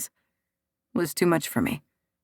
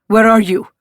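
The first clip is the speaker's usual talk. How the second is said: sharp, loud worried question; worried anger; 3 semitones higher, 7 dB louder than usual. Acted. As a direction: demand it, anxious and angry. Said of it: "The worry and anger in his voice".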